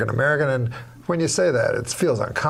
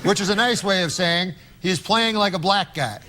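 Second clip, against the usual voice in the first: sportscaster; 7 semitones higher than usual. Acted. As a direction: call it like a sports announcer. Sportscaster joke read.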